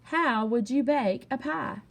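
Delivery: plain reading voice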